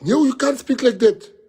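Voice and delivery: high, loud mock voice of the interviewer; mocking quote. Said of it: "He says".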